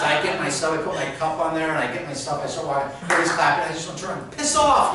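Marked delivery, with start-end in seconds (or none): 4.4-4.9 high, loud outburst; angry yell. Retold or imitated. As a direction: yell it at the whole crowd. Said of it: "I just want to yell something".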